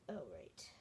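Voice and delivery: breathy whispered aside; whispering. Real, not acted. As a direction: whisper it to yourself.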